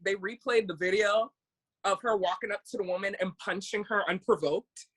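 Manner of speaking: ordinary talk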